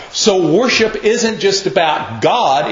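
ordinary talk, nothing marked

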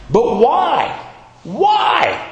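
rising to a high, full-throated cry of why; pleading cry. Acted. Abraham crying out why to God, high shout.

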